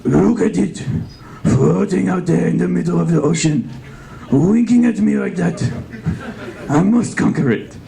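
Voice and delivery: high, grandiose warlord voice; conqueror voice. Retold or imitated. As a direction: gloat like a conqueror. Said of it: "He'd be like".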